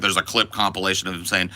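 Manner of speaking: ordinary talk, nothing marked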